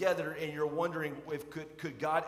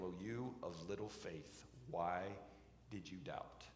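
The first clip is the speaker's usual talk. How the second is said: gentle rebuke; 9 semitones lower, 11 dB quieter than usual. Retold or imitated. Jesus gently rebukes sinking Peter.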